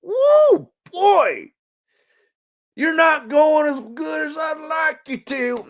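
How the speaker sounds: a light high-pitched mock shout; mock high exclaim. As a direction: squeal it like the day is talking back. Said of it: "sometimes it's like".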